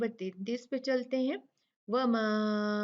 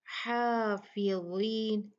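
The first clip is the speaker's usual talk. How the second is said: हाफिज़ीन drawn out slowly; slow recitation; much slower than usual. Shown as a demonstration. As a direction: stretch each syllable as in recitation. Recitation continues.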